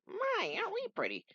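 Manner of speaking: very high, mocking compliment; squeaky old friend teasing. Archie character voiced by reader.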